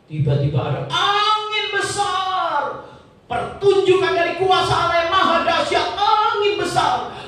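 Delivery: high, sing-song amazement at the wind; preacher marvelling at mountains splitting; awed storytelling. A narrator's reading. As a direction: paint a huge storm with wonder. Narrating God's great wind.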